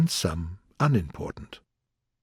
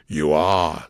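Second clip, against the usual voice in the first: low-pitched insistence; lightly voiced low King; contradicting King; 7 semitones lower than usual. A narrator's reading. King insisting to Alice, low and firm.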